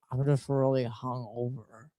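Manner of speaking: low, soft, mumbled excuse; quiet confession. Retold or imitated. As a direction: lean in and confess it under your breath. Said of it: "he leans in, he goes".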